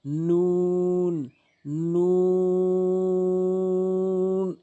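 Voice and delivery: "নুন" with a very long level vowel; demonstrated nun. Shown as a demonstration. Teacher shows learners the letter, "নুন" long and sustained. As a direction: hold "নুন" out, mouth rounded. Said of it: "এভাবে উচ্চারণ করবেন".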